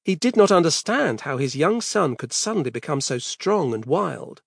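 usual speaking voice

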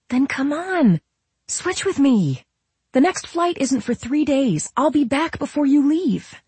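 high, urgent, pleading voice; very high-pitched, rushing to stop someone; Camille. A narrator's reading. Camille stopping Nola as she leaves, high and urgent.

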